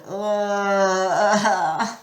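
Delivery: long, drawn-out groan; moan. A narrator's reading. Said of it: "Grover moaned".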